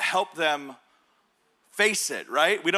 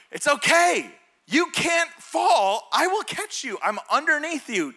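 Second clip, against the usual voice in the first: raised, high-pitched dad's call; urgent reassurance; 9 semitones higher, 4 dB louder, slower than usual. Retold or imitated. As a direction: call up firmly to a panicking child. Dad calls up to panicking son.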